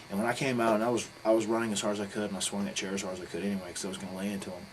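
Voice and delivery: ordinary talk, nothing marked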